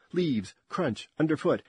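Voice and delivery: ordinary delivery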